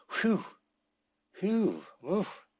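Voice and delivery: high, soft sighs of relief; relieved whew. Shown as a demonstration. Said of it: "What a relief".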